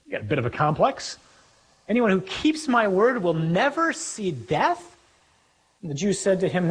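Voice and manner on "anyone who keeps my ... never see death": high, slow echo in disbelief; incredulous echo. Acted. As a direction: repeat it as an outrageous claim, pitch raised. Repeats the claim in disbelief.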